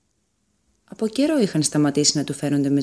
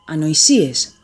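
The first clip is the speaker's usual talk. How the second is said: louder, dismissive scoff; scornful outburst; 6 dB louder, slower than usual. A narrator's reading. Leon jumping up at his brother, scornful and loud. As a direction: spit out the word in contempt. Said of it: "τινάχτηκε ο Λέων".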